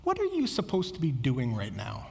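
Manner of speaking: low, soft, unraised question; imitating a leader who corrects without raising her voice; voice kept down. Children's leader redirecting a straying child, voice kept down. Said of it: "Her voice doesn't go up".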